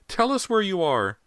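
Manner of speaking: very high, loud, slow terrified shriek; mother's terrified shriek at the unseen boy. A narrator's reading. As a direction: shriek in a terror never heard before.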